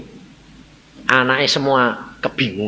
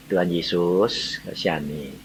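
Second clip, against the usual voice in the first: low, slow, soft prayer; 9 semitones lower, 6 dB quieter than usual. Retold or imitated. Brother praying over his sister's aching head, prayerful. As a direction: pray it softly and low, slowly.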